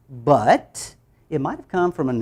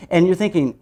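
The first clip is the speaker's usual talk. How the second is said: usual speaking voice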